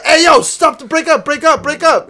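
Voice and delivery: teacher intervening. Retold or imitated. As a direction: imitate a teacher breaking up a fight. Teacher breaking up a fight.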